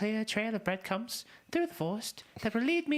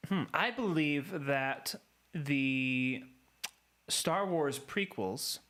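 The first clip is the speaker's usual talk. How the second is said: slow solemn voice; 6 semitones lower, much slower than usual. Acted. Mock solemn lost boy.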